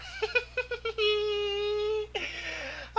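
squeaky, high, breathless giggle. Real, not acted. Laughing at his own broken webcam, high giggle. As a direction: let a thin high laugh escape.

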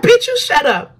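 high-pitched sassy retort; retold comeback. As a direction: fire back in a high voice. Retelling her comeback to the officer.

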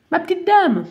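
put-on high, loud stuffy-nosed old man; a cold-ridden man calling out; sneezy man's voice. Sneezing man warns the stubborn lady, high loud voice.

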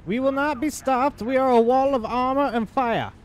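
heroic battle cry. Acted. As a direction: rouse the troops like a war general. Rallying tank crew in character.

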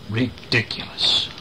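ordinary delivery

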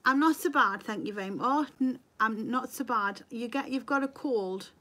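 usual speaking voice

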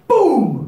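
loud, high shouted boom; shotgun boom. Shown as a demonstration. Storyteller imitating a shotgun blast for children, loud shouted boom. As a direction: blast it out like a gunshot. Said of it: "I'm gonna try to make how loud the noise is".

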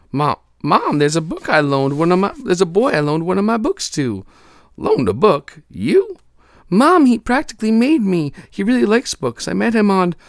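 put-on high, loud eager girl voice; breathless daughter pleading with mom; excited girl voice. Amanda imitated by mother.

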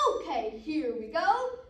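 loud, drawn-out wind-up; wolf gearing up, loudly; wolf's loud announcement. Acted. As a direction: announce it big and slow. Wolf readies to blow.

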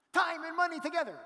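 imitating an overexcited friend insisting; ecstatic insistence. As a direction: shout it like the discovery of a lifetime.

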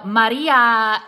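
Maria drawn out loud with a Spanish tapped r; mock Spanish accent. Vlogger mocking newscasters switching accents, exaggerated Spanish accent. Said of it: "in, like, the Spanish accent".

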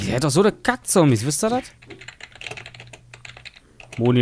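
ordinary delivery

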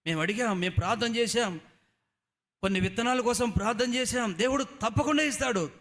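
high, loud rising fervour; loud high sermon cry; preaching at full force. Acted. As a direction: build to a ringing promise. Preacher builds to assurance.

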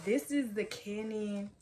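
usual speaking voice

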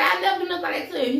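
high, rapid protest; pleading daughter. Acted. Daughter held by guards protests to dad, pleading. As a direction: rush out a high-pitched denial.

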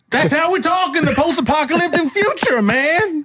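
very high, strained bandit voice; squawking high post-apocalyptic gang voice; post-apocalyptic bandit accent. Acted. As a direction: answer in the firestarter's hammed-up accent. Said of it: "It's just the accent".

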